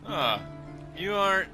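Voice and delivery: ordinary delivery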